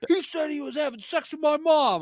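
high whiny tattling to the ref; whiny complaint. Retold or imitated. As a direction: whine it to the referee. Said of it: "he was just like".

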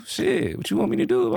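high, squeaky half-laughing plea; exasperated protest. Real, not acted. Answering his friend's teasing with a high exasperated plea. Protesting he does love her.

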